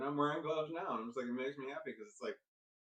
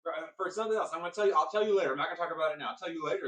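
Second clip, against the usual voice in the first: loud, higher, evasive brush-off; flustered dodging; 5 semitones higher, 8 dB louder, faster than usual. Retold or imitated. Mother dodging the question at the table, flustered and loud. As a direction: bluster to end the subject.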